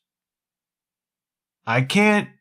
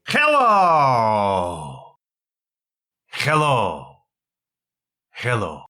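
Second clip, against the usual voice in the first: hello greeting; 2 semitones lower, much slower than usual. Pretend. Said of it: "Should I start doing the voices".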